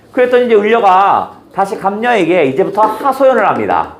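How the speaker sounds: ordinary delivery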